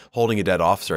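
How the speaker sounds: ordinary talk, nothing marked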